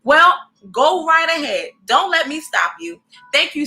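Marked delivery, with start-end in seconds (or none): none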